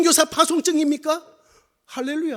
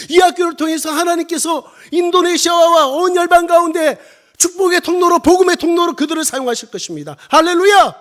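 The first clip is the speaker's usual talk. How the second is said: raised, rousing proclamation; full-force preaching; 3 semitones higher, 5 dB louder than usual. Real, not acted. Missionary proclaims the school's future to congregation, full-force preaching. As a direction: build to a loud 할렐루야.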